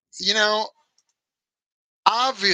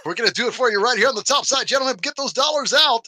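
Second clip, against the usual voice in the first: strip club DJ voice; 5 semitones higher than usual. Retold or imitated. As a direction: hype the crowd like a strip club DJ. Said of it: "my old strip club D J voice".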